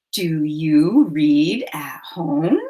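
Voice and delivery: slow breathy whisper. Shown as a demonstration. Teacher whispering the sentence for counting. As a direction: whisper it slowly, word by word. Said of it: "Say it with me".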